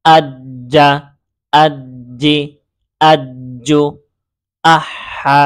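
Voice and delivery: measured drill of separate words, doubled middle sound as in আজ্জি; whole syllables joined, আজ্জা, আজ্জি, আজ্জু, evenly spaced; pronunciation without spelling. Shown as a demonstration. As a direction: recite each like আজ্জা, holding the doubled consonant, then pausing. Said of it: "আমরা পরের line থেকে উচ্চারণ করব".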